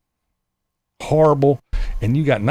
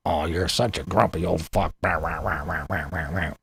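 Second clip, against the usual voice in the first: low, softer mocking mumble; mock whiny critics; 10 semitones lower, 8 dB quieter than usual. Mocking commenters who call him grumpy, low and dismissive. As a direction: mimic the complainers, dismissive. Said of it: "you bitch and you complain".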